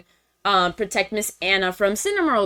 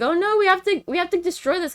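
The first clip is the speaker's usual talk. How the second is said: high, put-on panicky voice; mock alarm; 9 semitones higher, much faster than usual. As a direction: imitate a worried gang, pitched up. Voicing characters alarmed by monster.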